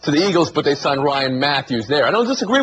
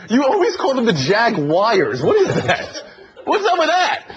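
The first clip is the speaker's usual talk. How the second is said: much higher, breaking into laughter; laughing tease; 6 semitones higher than usual. Real, not acted. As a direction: tease him while cracking up. Teasing about Jaguars, laughing.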